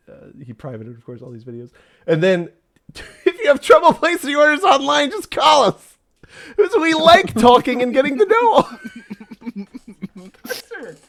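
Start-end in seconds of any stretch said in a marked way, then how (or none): 3.3-11.0 high, loud, laughing sales pitch; laughing ad read. Acted. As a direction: giggle through the ad.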